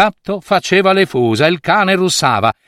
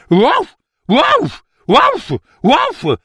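barking dog; 12 semitones higher, much slower than usual. A narrator's reading. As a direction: bark like a startled dog. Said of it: "faceva il cane".

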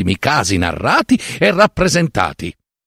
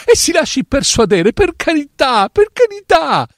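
high, anguished begging that climbs higher; desperate plea; 8 semitones higher than usual. Acted. Doctor Fileno begging the author not to dismiss him, climbing anguished plea. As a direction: beg him not to send you away, more frantic each time. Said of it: "e disperata angoscia".

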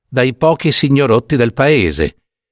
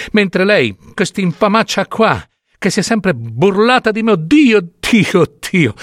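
anguished outcry; 7 semitones higher than usual. A narrator's reading. Mother cursing the false scribe.

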